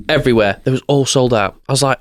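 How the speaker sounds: ordinary talk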